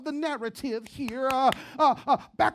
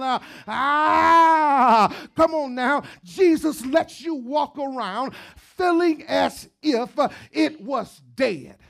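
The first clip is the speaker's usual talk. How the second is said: full-throated cry at the climax; preacher shout; 8 dB louder, slower than usual. Acted. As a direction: shout it out over the congregation.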